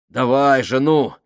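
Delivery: loud encouraging cry; urging shout. A narrator's reading. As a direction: push him on, loudly. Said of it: "подбодрил Фабрини". (громко подбадривая)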